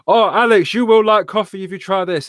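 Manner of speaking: high, bright-eyed sales pitch; high excited upsell voice; chirpy coffee seller pitching hard; mock barista. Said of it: "It was like".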